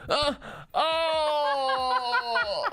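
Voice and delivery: high-pitched drawn-out cry of dismay; hammed-up agonized moan; dramatic imitated wail. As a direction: wail "oh" as if you just busted. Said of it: "there's, like, a physical reaction".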